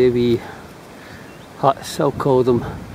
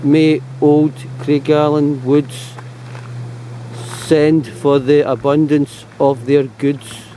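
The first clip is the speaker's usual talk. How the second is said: slow, louder verse recital; reciting a verse; 4 dB louder, slower than usual. Shown as a demonstration. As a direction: recite it slowly like a poem. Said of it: "saying here".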